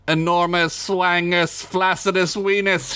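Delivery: hammed-up spell shout. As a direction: chant it like a sorceress. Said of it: "she shouted".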